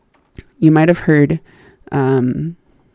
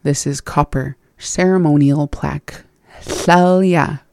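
ordinary talk, nothing marked

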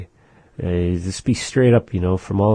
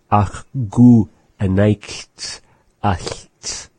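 slow and clear; 3 semitones higher, 5 dB louder, much slower than usual. Shown as a demonstration. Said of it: "now ach is back to ach".

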